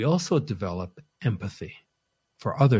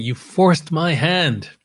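exasperated Paul; 7 semitones higher, 7 dB louder, slower than usual. Acted. Preacher voicing Paul's exasperation.

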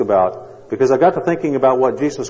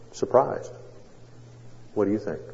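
ordinary talk

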